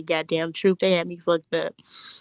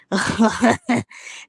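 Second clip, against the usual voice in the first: laugh; 3 semitones higher, 5 dB louder than usual. Real, not acted. Laughing at own joke.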